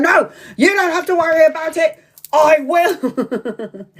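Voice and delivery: high mock outburst breaking into laughter; indignant insistence. Insisting she will worry for her friend, indignant. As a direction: insist hotly, then crack up.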